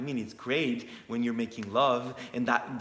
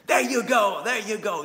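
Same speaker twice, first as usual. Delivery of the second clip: calling out; 7 semitones higher, 7 dB louder than usual. Real, not acted. Calling out to son joining.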